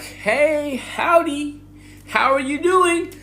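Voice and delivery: high, sing-song cheery greeting; overly friendly locals. Retold or imitated. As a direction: gush hello like a too-nice stranger. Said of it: "they're all like".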